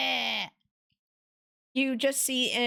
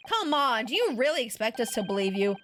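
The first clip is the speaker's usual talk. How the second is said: usual speaking voice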